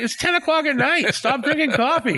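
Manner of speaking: high laughing scold at mom; mock scolding. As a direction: tease mom to stop drinking coffee.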